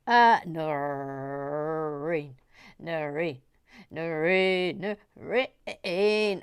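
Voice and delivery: lazy drawn name call. Pretend. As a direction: call her name like a sing-song.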